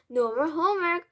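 loud, high cheer; gleeful boy. A narrator's reading. Mouse boy celebrates no homework, gleeful cheer. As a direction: announce it with boyish glee.

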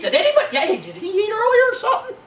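high-pitched, hurried mock disciple voice; clueless disciples. Disciples asking about food, mocking high voice. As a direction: squeak it out, half laughing.

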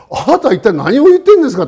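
high, loud indignant outburst; angry retort. Retold or imitated. The father rebuffing the stranger who asks for his daughter, loud high indignant shout. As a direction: shout back in outrage at the stranger. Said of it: "これ、怒りますよ".

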